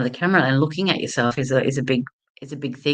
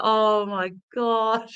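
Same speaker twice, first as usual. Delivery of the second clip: slow, higher, stunned gasp of dismay; shocked; 7 semitones higher, much slower than usual. Real, not acted. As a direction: drag it out in horror. Sees hair all over his suit.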